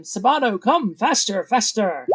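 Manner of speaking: high-pitched urging yell, not full volume. Acted. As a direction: hurry along a lagging servant. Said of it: "yelling at him".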